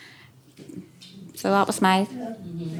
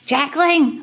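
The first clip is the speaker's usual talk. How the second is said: higher, louder call of the name; 7 semitones higher, 4 dB louder than usual. Retold or imitated. Older sisters calling Jacqueline out to climb, a loud high call. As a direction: shout for her across the back lanes.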